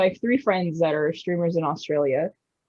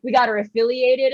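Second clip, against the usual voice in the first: normal talking voice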